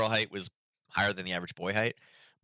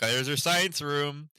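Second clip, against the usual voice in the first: slow, higher teasing sing-song; 7 semitones higher, slower than usual. Retold or imitated. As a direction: poke fun at the grumpy son, slowly. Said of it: "I was laughing and, you know, poking him".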